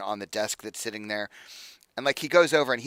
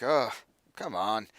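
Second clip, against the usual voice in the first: sleepy grumbling; slower than usual. Acted. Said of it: "you hear some grumbling from inside".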